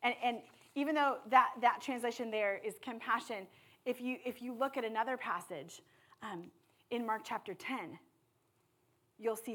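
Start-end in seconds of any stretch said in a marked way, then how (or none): none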